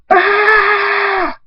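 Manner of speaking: long, very high scream. A narrator's reading. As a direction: hold a high acted scream. Character attacked, screaming.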